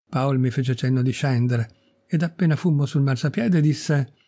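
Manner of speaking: plain reading voice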